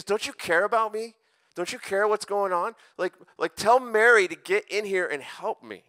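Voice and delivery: high, loud acted protest; indignant complaint. Martha interrupting Jesus to get Mary's help, indignant and high. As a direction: demand it like you've been left with everything.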